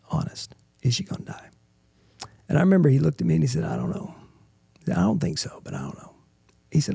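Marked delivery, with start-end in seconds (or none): 0.1-1.5 soft unvoiced whisper, barely there; whispered plea. Husband asking the doctor if his wife will die, hushed whisper. 3.6-6.1 low, much softer, near whisper; hushed doctor. Retold or imitated. Doctor telling the husband he does not know, low and hushed. Doctor's grave uncertain answer.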